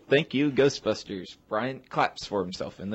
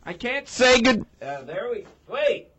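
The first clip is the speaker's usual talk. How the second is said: clipping distorted mic; 13 semitones higher than usual. Real, not acted. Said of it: "Stop yelling into the mic".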